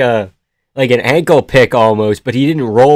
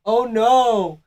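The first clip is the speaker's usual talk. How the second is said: high, drawn-out mocking "oh no"; hammed-up high-pitched worry; mock dismay; 8 semitones higher, 3 dB quieter than usual. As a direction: mimic a fighter's helpless dismay. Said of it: "he's like".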